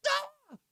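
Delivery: high mock scream of rage; sudden high-pitched freak-out shriek; spazzing-out scream. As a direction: scream as if losing it. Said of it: "You spaz out".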